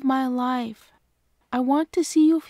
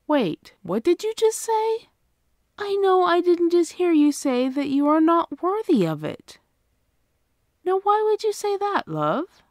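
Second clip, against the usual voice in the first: surprised; 5 semitones higher than usual. Acted. Partner says they're unworthy.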